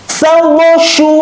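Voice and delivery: high, crisp Quran letters; fast, firm recitation. Shown as a demonstration. Teacher shows pupils fast, firm letter reading, high and crisp. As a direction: snap out each syllable firmly. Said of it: "tegas, dan benar".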